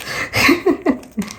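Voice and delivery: airy high giggle; breathy laugh. Real, not acted. Laughing at the memory.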